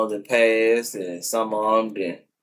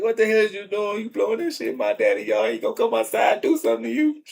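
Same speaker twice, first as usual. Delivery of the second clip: dog scolding neighbours; 11 semitones higher, faster than usual. Retold or imitated.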